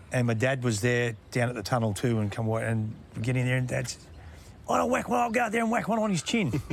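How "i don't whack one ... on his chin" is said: imitating angry dad. Dad at the tunnel, threatening the coach, in a high mock-angry voice. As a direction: play the fired-up dad wanting to punch him.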